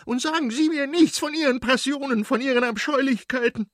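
high-pitched, furious screaming; piercing scream. A narrator's reading. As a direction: keep shrieking at him in disgust. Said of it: "schrie sie noch durchdringender". (durchdringend und empört schreien)

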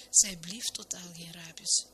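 ordinary delivery, nothing marked

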